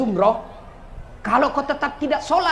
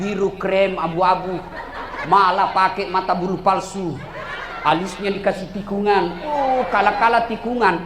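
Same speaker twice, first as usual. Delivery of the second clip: ordinary talk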